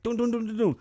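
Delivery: high, loud dun-dun knocks; imitated door knocking. Dog knocking while cameras watch, voiced knocks. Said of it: "he's like".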